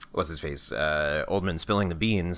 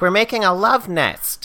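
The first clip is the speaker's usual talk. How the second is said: high, loud, drawn-out mock yell; mock calling out; 12 semitones higher, 6 dB louder, slower than usual. Woman answering the maid at the door, high mock yell. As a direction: sing it out loudly at a high pitch. Guest yelling through motel door.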